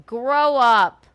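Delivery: a sharp loud reprimand; fed-up command, loud; loud scold. Real, not acted. Scolding the haters.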